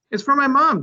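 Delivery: put-on high, bright little girl; child voice. A narrator's reading. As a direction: voice a small girl proud of her drawing. Child Julia answers.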